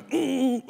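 high closed-mouth squeal of pain; tight-lipped comic "mm" of agony; stifled pained whimper. Retold or imitated. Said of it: "just going".

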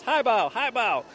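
high-pitched, drawn-out excited call; imitated toddler scream. Father imitating his two-year-old spotting the mascot, mock scream. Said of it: "he screams".

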